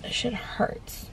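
breathy hushed complaint; pained whisper. Real, not acted. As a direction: whisper it through the sting. Complaining about painful scrape.

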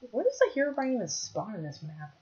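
ordinary talk

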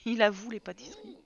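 usual speaking voice

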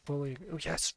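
usual speaking voice

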